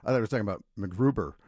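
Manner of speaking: ordinary talk, nothing marked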